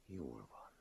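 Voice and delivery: very soft, hushed acceptance; gentle. A narrator's reading. Master soothes the remorseful man, gently. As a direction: let it drop almost to nothing. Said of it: "folytatta szelíden a mester".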